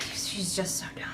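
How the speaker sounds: voiceless, despairing aside; exasperated whisper. Real, not acted. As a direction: drop to a whisper in sheer exasperation. Exasperated aside about the heroine.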